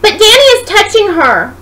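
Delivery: put-on high, loud little girl's voice telling on him; tattling child. Little Sarah telling her mommy on Danny, high loud child voice. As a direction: tattle like a gleeful toddler.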